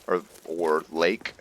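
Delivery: ordinary talk, nothing marked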